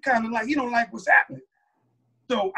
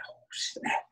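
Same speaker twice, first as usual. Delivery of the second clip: hushed whisper aside; whispered dismay; 11 dB quieter than usual. Retold or imitated. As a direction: whisper it like you know you're in trouble.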